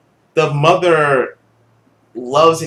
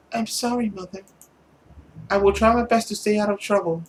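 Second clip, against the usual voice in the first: put-on higher, small girlish plea; pleading daughter; 6 semitones higher, 5 dB quieter, faster than usual. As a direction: beg mother gently to stay. Voices the girl apologizing.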